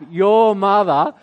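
slow, loud "your mother" to needle the wife; pointed stress on your. Retold or imitated. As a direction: drag out "your" in "your mother" with mock pettiness.

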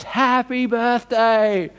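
crowd shout of surprise. Retold or imitated. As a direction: call it out like a room of friends springing a surprise. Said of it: "everyone screams".

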